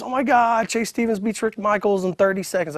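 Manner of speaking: imitating breathless hype over an upset; high, sustained commentary voice; excited announcer. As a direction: sell the shock result to viewers.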